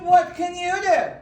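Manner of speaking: loud, high royal challenge; demanding queen. Acted. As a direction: boom it down at her from the throne. Queen challenges new princess.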